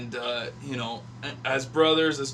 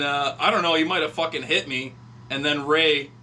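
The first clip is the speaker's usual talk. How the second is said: ordinary talk, nothing marked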